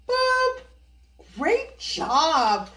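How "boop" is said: playful boop. Acted. Counting game page turn.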